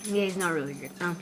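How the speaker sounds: normal talking voice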